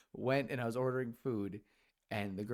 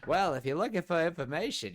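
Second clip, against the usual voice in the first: high, loud old cartoon clown voice; John Mulaney; 6 semitones higher, 7 dB louder than usual. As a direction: answer back as a sarcastic comedian droid. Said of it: "I made John Mulaney sound".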